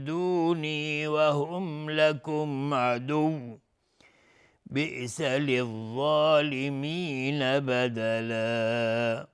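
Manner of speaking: slow, melodic tartil chanting; Quran recitation. Acted. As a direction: draw out every verse with a flowing chant. Recites next verse melodically. (تلاوت آهسته و آهنگین قرآن)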